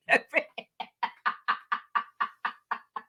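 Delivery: long breathy run of chuckles; helpless laugh in a string of soft bursts; real laugh. Real, not acted. Vlogger tickled by a story of cheating at Uno, laughing.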